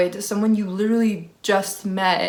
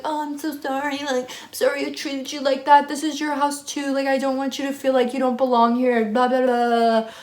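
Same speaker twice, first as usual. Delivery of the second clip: higher, gushing imitation of an apology; mock apologetic roommate; 5 semitones higher than usual. Roommate apologizing to the speaker, in a high mock-sorry voice. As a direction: play the over-sorry roommate, pitched up. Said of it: "And she's like".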